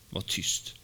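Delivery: whispered command to be still; stern hush. A narrator's reading. Jesus rebuking the storm, whispered stern command. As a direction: hush the wind under your breath. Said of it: "talade strängt till vinden".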